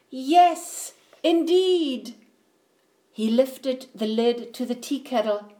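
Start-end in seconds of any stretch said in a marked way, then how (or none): 0.1-2.1 high, louder celebration; triumphant. A narrator's reading. Bear finds the fridge mouse-free, triumphant. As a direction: crow with satisfaction.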